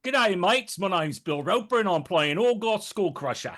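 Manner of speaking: cheery Australian twang; Australian accent. Pretend. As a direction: put on an Aussie accent for the intro. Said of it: "I don't know where that accent came from".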